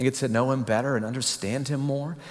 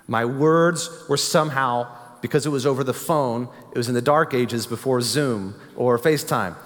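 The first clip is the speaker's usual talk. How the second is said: ordinary talk, nothing marked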